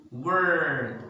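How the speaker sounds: "word" held on a strong R; drawn-out American R in "word"; stressed R. Shown as a demonstration. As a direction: stretch "word" like "wurrrd". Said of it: "they stress the R".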